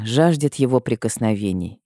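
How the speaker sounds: ordinary delivery, nothing marked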